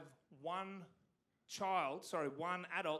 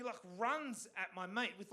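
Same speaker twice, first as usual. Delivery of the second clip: ordinary talk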